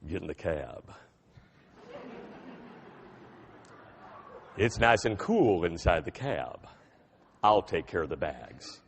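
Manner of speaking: very low, slow Texan voice; deep Texan drawl. Retold or imitated. Cab driver greeting a customer in a deep Texan accent. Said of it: "he says in this deep Texan accented voice".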